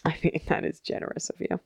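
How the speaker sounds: quick, hushed mock praise; dry teasing. Real, not acted. Host ribbing cohost's estimate, hushed and quick. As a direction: tease her gently. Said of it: "teasing cohost".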